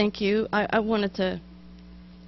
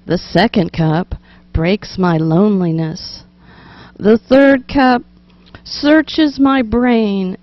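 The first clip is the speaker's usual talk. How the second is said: slow, declaiming louder tea poem; poem reading; 6 dB louder than usual. Acted. Host reading an old tea poem to listeners, slow and declaimed. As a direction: read each cup out with weight. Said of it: "no, let me give that to you again".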